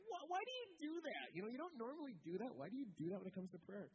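high-pitched, baffled "why" that eases down; mock-baffled questioning, quick after the first burst; incredulous retold question. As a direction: blurt it out in disbelief, starting very high. Retold blurted question mid-prayer while laughing.

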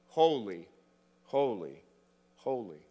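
"holy, holy, holy" low, each word falling after a pause; solemn emphatic repetition. Shown as a demonstration. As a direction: weigh every "holy" separately, fading on the last. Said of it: "said to the third degree".

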